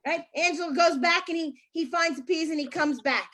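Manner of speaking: ordinary talk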